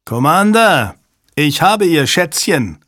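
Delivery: loud, high threatening shout. A narrator's reading. Hostage-taker to the commander in the dark, shouting. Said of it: "schrie Hale ins Dunkel".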